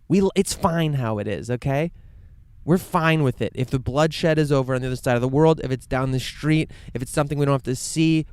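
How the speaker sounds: imitating irritated, dismissive people; indignant quoted retort, pushed hard; mock dismissive anger. Acting out dismissive, complacent people.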